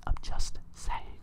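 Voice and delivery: hushed conspiratorial whisper. Real, not acted. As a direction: drop to a secret whisper. Conspiratorial aside about piracy.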